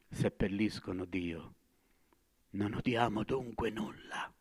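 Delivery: soft and low; hushed. Acted. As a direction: drop almost to a murmur. Madman falls to a hush.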